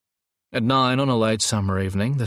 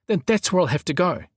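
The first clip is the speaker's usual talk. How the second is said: higher, insistent objection; protesting; 10 semitones higher, 3 dB louder than usual. A narrator's reading. Gazza insists on going into the house, protesting. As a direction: argue back with pitch well up. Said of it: "he protested".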